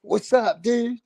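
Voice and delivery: light imitation of an American; a cousin in America greeting him; mock American accent. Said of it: "my cousin's in America".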